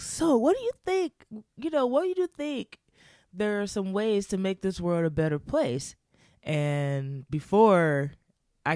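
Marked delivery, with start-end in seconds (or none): 0.0-5.9 high, lilting voice for the friend's earnest question; quoting friend. Retold or imitated.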